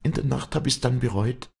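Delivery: high, impassioned confession in a woman's voice; anguished high-pitched outpouring; distraught Wally. Acted.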